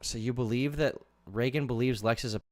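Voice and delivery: usual speaking voice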